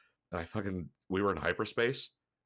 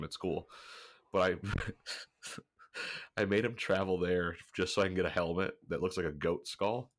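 ordinary talk, nothing marked

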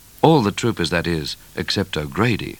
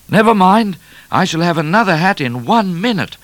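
high, loud carefree call; raised, untroubled shout; 12 semitones higher, 6 dB louder than usual. A narrator's reading. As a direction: call it back over your shoulder. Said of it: "called Midnight".

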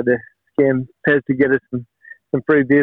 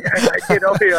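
mock shout at the coach; 7 semitones higher than usual. Shouting at coach taking bus.

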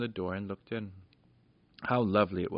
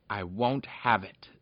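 angry shout; slower than usual. A narrator's reading. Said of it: "shouted Peter in rage".

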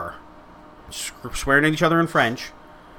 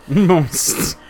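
slow, drawn-out laughing repeat; laughing echo; 2 semitones higher, 5 dB louder, much slower than usual. Acted. Echoing his Quebec swear with a laugh.

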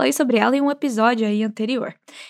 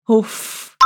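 short tired exhale on Uf; weary sigh uf. Shown as a demonstration. Teacher models a weary Uf for students, sighing. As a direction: breathe out Uf like a sigh. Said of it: "Repete comigo".

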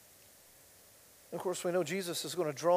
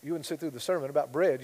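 ordinary delivery

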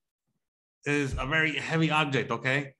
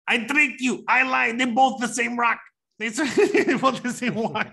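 high, loud triumphant crowing; gloating glee; 10 semitones higher, 6 dB louder than usual. Acted. Teasing his friend after the fake wok lesson, high gleeful gloating. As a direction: crow it, breaking into laughter at the end.

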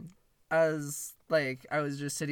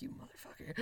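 very soft, whispered cursing; muttered curse; 15 dB quieter, faster than usual. Retold or imitated. Clerk told to clean up shaved hair, muttered curse. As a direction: curse under your breath. Muttered reaction to boss's order.

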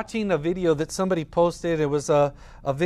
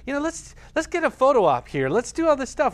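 higher and louder, hamming up a photo op; mock show-off; 8 semitones higher, 4 dB louder than usual. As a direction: overplay the attention-seeker. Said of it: "It wasn't like".

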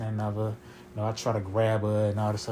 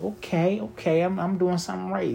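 higher, pleased with himself; a cocky little thrill in the voice; excited; 8 semitones higher than usual. Retold or imitated. Man in bed reacting to her praise, excited and much higher.

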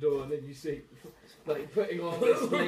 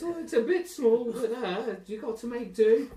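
dresser's voice; 8 semitones higher, 5 dB louder than usual. Acted. Dresser pushing a small dress.

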